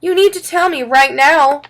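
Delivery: high, loud parental demand. A narrator's reading. Mom wants an answer from Freddie right now, demanding. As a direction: insist on an answer at once. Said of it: "my mom demanded".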